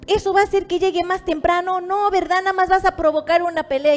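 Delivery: insistent maternal scolding, rising in pitch; mother's voice. Retold or imitated. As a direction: warn your grown child off a fight. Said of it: "la voz de mi madre".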